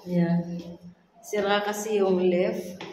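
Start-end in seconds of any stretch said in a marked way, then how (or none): none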